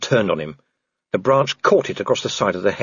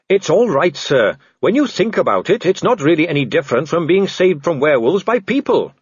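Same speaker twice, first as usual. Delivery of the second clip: higher, earnest cheerful officer; Carrot, reassuring; 5 semitones higher than usual. Acted. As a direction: explain it helpfully to a superior. Voices Carrot reassuring Vimes.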